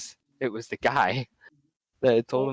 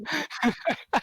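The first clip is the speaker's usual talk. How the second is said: high laugh; 4 dB quieter than usual. Real, not acted. Friend reacts to the story.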